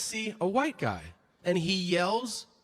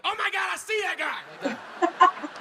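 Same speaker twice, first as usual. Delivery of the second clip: much higher and fast, mock thrilled; excited; 13 semitones higher, 3 dB louder than usual. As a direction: gasp it out like a wide-eyed fan. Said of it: "Only I was like".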